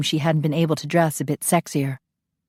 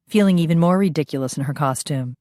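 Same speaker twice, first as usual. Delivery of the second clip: plain reading voice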